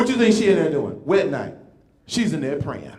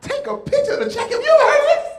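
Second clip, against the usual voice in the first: much higher, rising incredulous squeal; high hammed-up astonishment; mock disbelief; 4 dB louder than usual. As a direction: act stunned at the strange request. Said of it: "I'm like".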